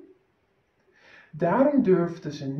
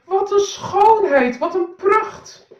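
much higher, slow drawn-out praise; admiring cries; 11 semitones higher, 6 dB louder, slower than usual. Acted. Servants praise the invisible clothes, high and slow. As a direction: gasp it out in fake awe. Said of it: "knikten goedkeurend en riepen".

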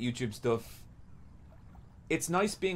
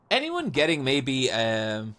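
ordinary delivery

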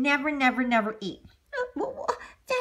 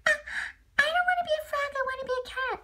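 put-on high, whiny kid frog; little frog child; 13 semitones higher, much faster than usual. Son frog whines to Daddy in a high child voice. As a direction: pipe up like a small frog child.

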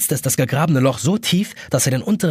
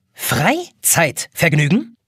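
"Freizeitvergnügen" pulled apart slowly, each part punched; every part stressed; 4 semitones higher, much slower than usual. A narrator's reading. Egg timer repeating "Freizeitvergnügen" indignantly, each part stressed. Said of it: "Die kleine pinke Eieruhr betont jedes Wort".

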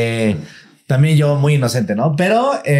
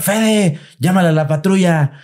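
mom calling out; 7 semitones higher than usual. Retold or imitated. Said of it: "me empezó a gritar".

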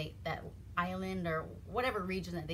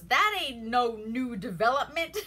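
laughing through the line; 4 semitones higher, 7 dB louder than usual. Retold or imitated. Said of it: "Samantha goes".

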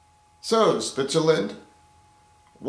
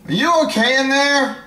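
high character voice; 10 semitones higher, 5 dB louder than usual. Acted. Knocking on occupied bathroom door.

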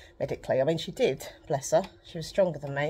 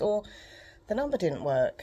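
ordinary talk, nothing marked

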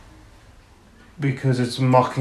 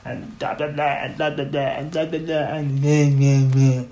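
nonsense babble rising into whiny mehs; mocking commenters' squabble. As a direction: mimic petty bickering in meaningless syllables. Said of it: "it's everyone just shouting at each other".

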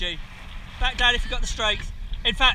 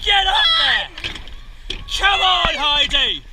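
race coxing calls; 7 semitones higher, slower than usual. Real, not acted. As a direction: push the crew hard, shouting each stroke. Cox calling rowing race.

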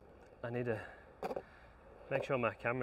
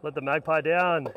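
frustrated loud; 4 semitones higher, 9 dB louder than usual. Real, not acted. After missed try, yelling.

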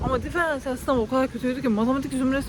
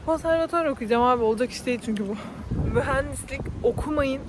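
ordinary delivery

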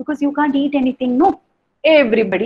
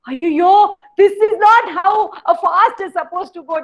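upset chief; 10 semitones higher, 4 dB louder than usual. Acted. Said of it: "Chakrapani was now very, very upset".

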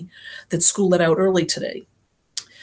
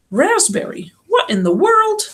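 high, startled cry of disbelief; shocked doctor; 13 semitones higher, 4 dB louder, slower than usual. Acted. Father figure catching girl cutting school, shocked. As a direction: catch her out, aghast and loud. Doctor finds her washing cars.

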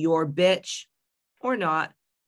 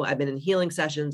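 usual speaking voice

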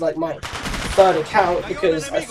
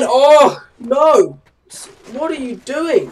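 higher-pitched outburst of alarm; exclaiming in dismay; 6 semitones higher, 5 dB louder, slower than usual. Real, not acted. Gamer reacting to his own slip, exclaiming in dismay. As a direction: cry out at a blunder. Calling out at the game.

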